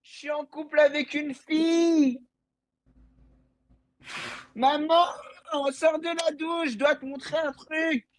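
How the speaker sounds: high, giddy yes yes yes; overjoyed. Real, not acted. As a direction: squeal with joy at the news. Kid thrilled girl said yes.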